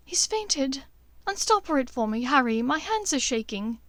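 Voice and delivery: frightened Hermione. Acted. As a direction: Hermione asks for help, shaken.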